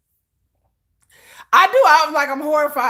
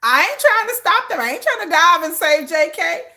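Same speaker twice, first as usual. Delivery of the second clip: mock bystander call; 11 semitones higher than usual. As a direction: shout encouragement while not helping. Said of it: "He was like".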